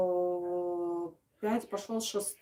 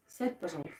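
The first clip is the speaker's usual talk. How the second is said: quiet aside; 5 semitones lower, 10 dB quieter, faster than usual. Real, not acted. Hushed aside to her son.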